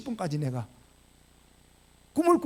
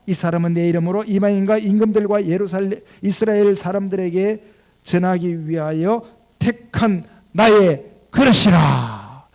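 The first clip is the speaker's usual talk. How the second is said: louder, slowing, solemn divine command; the Lord commissioning Paul, solemn and louder; 2 semitones higher, 8 dB louder, slower than usual. Retold or imitated. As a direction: pronounce it like God's decree. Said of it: "주께서 이르시되".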